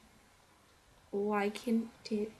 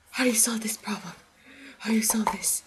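fast, urgent whisper; frantic whispering nerd; 3 dB louder, faster than usual. Retold or imitated. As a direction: whisper the question in a panic. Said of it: "doing stuff like this".

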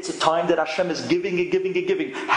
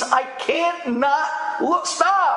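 desperate shout; 10 semitones higher, slower than usual. Retold or imitated. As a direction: burst out, begging him to stop. Pleading climax to father.

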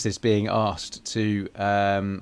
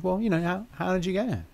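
higher-pitched retold coaxing; retold prodding question; 9 semitones higher, 4 dB quieter than usual. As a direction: lightly act out a leading question. Boss's prodding reply retold.

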